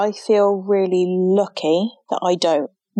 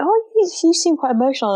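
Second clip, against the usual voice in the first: high, quick imitating voice; a school staff member at the meeting, lightly mocked; mocking staff remark; 10 semitones higher, faster than usual. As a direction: parody the school staff member, pitched up and hurried. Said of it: "someone was like".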